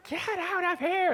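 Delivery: high-pitched, stretched mock yell at moderate volume; imitated scream. Commentator re-enacting player's scream at opponent, mock yell. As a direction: mimic a scream, slow and high. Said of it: "screaming in his face".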